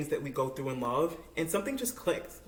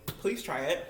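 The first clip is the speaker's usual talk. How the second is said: normal talking voice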